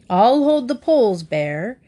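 put-on loud roaring old captain. A narrator's reading. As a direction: roar it from inside the tent. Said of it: "he roared".

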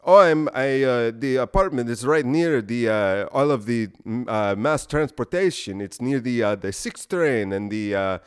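put-on Indian accent with hesitant stops and starts; rough Indian accent. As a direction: imitate a landlord fumbling directions in an Indian accent. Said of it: "am I doing an Indian accent?".

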